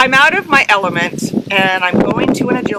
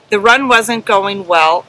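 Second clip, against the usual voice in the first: ordinary delivery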